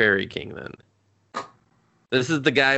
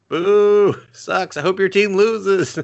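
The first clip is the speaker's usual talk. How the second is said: higher, louder jeering boo; mock heckle; 9 semitones higher, 6 dB louder, much slower than usual. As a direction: heckle the losing team. Mock heckling acted quote.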